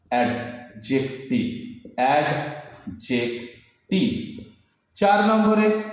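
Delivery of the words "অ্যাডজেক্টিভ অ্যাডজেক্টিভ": Adjective very slowly, split as অ্যাড-জেক-টিভ; syllable by syllable. Shown as a demonstration. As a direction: break adjective into three parts with clear pauses. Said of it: "syllable ভেঙে ভেঙে বলতে হবে". (ভেঙে ভেঙে, ধীরে ধীরে উচ্চারণ)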